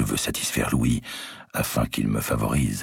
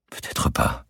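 muffled voice; slower than usual. Acted. Marguerite consenting to her cousin, muffled whisper. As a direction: give in, in a stifled whisper. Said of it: "d'une voix étouffée, consentante".

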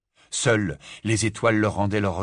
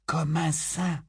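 soft, higher-pitched murmur; weak murmur; 7 semitones higher, 5 dB quieter, slower than usual. A narrator's reading. George waking wounded answers his mother, weak murmur. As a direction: murmur it feebly, barely awake. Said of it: "murmura-t-il".

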